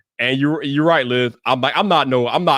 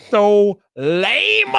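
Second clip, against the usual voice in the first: long, stretched-out LAME; drawn-out exclamation; 3 semitones higher than usual. Retold or imitated. Streamer recalling his reaction to viewers, drawn-out exclamation. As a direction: draw the last word right out.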